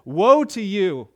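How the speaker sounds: loud, slow woe; preacher voicing Jesus' warning; solemn warning. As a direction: drag out the woe like a verdict.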